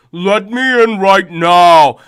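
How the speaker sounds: loud, slow demand; older brother demands to be let in, loud; angry brother. Retold or imitated. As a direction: pound out the order through the door.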